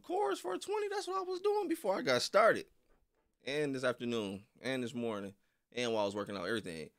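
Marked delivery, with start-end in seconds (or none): 0.0-2.6 very high squeaky alien voice. Real, not acted. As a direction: pitch way up like a tiny alien. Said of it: "Oh, you sound like an alien".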